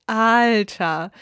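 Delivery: a loud laughing „Alter“. Acted. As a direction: blurt it out through a laugh. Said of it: "sagte sie nur lachend". (lachend und laut)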